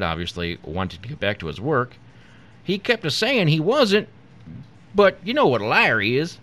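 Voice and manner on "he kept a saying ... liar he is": high-pitched country farmer; rustic punchline, pitched high; weary farmer. A narrator's reading. Farmer wanting to get back to work, unbothered. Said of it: "the farmer sighed".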